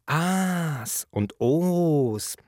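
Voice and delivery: drawn-out, high oohs and aahs; imitated admiring chorus. Narrator imitating admiring kindergarten children, drawn-out wonder. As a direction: stretch out mock cries of wonder.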